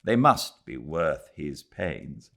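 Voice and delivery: plain reading voice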